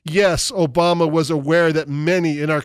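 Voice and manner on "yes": normal talking voice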